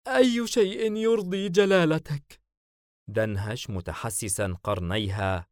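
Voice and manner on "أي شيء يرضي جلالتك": very high, louder female voice; strained smiling submission. A narrator's reading. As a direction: submit through a forced smile. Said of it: "وابتسمت قائلة". (خضوع مبتسم بصوت عال)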